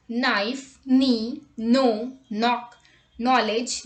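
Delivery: "Knife", "knee", "knock" and "knowledge" start straight on N; no K before the N in "knowledge", said nol-idge; silent K. Shown as a demonstration. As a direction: clear list of KN words. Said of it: "K is not pronounced when it comes before N".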